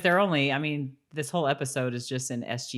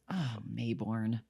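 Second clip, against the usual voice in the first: exasperated sigh; 5 semitones lower, 9 dB quieter, slower than usual. Retold or imitated. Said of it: "you're just like".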